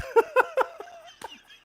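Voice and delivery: shrill high squeal of laughter. Real, not acted. Laughing at his own movie quote. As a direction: crack up high.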